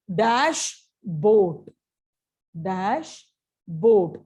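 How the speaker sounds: ordinary talk, nothing marked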